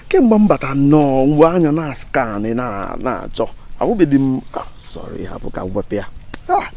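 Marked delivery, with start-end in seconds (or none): none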